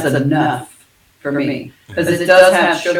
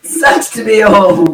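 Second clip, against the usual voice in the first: mock lament; 5 semitones higher, 4 dB louder, slower than usual. Joking about her age.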